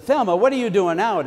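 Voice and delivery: calling out in surprised concern. Retold or imitated. Grandma surprised at door.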